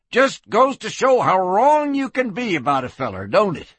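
put-on high-pitched, folksy old soak; elated town drunk. Freed drunk marveling at the sheriff, elated.

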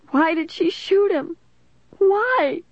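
high-pitched anguished wail; tearful. A narrator's reading. Caitlin performing grief for police, high and tearful. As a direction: question it in high, tearful distress. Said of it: "I heard Caitlin mumble through her tears".

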